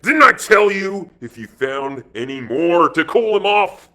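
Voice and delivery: loud, high, angry rebuke; snapped. A narrator's reading. Gang boss scolds his man over a stranger, snapped. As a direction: snap it out as a boss's reprimand. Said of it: "he snapped".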